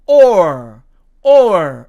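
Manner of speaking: OR with the r sounded; American or variant. Shown as a demonstration. Teacher showing the other American way, OR with r. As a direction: finish OR on a clear loud r. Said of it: "미국식으로".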